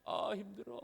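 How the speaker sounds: soft, weary mock groan; acted weariness. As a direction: groan it softly, like waking up worn out. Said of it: "몸이 힘들면".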